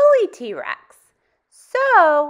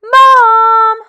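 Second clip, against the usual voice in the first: calling out; 9 semitones higher, 15 dB louder than usual. Acted. Scared child calling his mother, loud and high. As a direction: shout Mom much higher and louder. Child calls parents at night.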